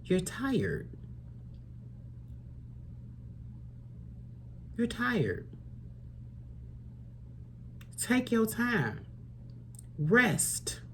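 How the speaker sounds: soft, quiet and gentle, slowing down; gentle reassurance. Real, not acted. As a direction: ease the tired listener into rest. Tarot reader soothing tired viewer.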